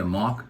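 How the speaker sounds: "Mark" said low as "Mahk"; R dropped. Retold or imitated. East Coaster giving his name to a clerk, "Mark" without R. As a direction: give "Mark" with no R. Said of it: "we don't pronounce our R's and L's".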